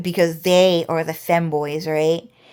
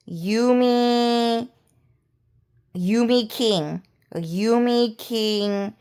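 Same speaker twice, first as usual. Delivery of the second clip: lightly imitated cute girl, drawn out; cute, innocent girl; 3 semitones higher, much slower than usual. Imitating Chinese girls that sound really cute, lightly mock-innocent. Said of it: "sound really cute and sound really innocent".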